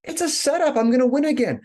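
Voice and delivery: high, loud frustrated outburst; Pip, realizing the game is rigged, fumes in a high, loud voice; pissed off. Retold or imitated. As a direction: blurt it out furious at the rigged game. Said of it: "He gets so pissed".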